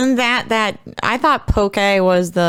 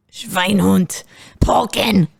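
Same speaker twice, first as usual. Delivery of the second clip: guttural mock German, throaty; German accent; 3 semitones higher, much slower than usual. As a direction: gag on it like a cartoon German. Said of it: "like they're, like, gagging".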